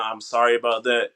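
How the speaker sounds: ordinary talk, nothing marked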